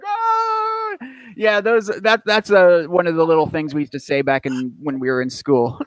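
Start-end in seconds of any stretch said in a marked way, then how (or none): 0.0-1.0 very high squeaky meme shriek; cartoonish high-pitched shriek; meme sound effect. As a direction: imitate the silly meme scream. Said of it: "those dudes are like".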